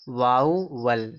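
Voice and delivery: "वाउवल" slowly in two parts, vau-wal; drawn-out demo pronunciation. Shown as a demonstration. Teacher shows students how the word is read, drawn-out "वाउवल". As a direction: stretch "वाउवल", a long vau, then wal. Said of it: "इसको देखो पढ़ा जाएगा".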